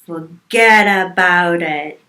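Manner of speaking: loud, slow Italian-American "forget about it"; attempted Italian-American accent. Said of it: "That was closer".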